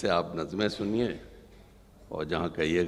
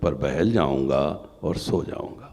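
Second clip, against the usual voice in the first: loud then falling poem close; stately nazm recitation; 5 semitones higher, 12 dB louder than usual. Acted. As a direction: let the last line settle down.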